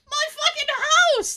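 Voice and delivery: high-pitched wail of protest; acted distressed outburst; mock outcry. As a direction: cry it out as if you just lost everything. Imagines reacting to losing his house.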